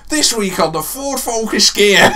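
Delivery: slow, high, loud presenter parody. Pretend. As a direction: announce it like a car show host. Parodying Top Gear host intro.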